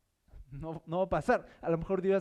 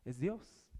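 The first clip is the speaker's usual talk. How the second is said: much softer, hushed correction; Elí explaining softly; 2 semitones lower, 11 dB quieter than usual. Pretend. Elí quietly telling Samuel it is God, hushed. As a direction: gently tell him it's God. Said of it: "él le dice".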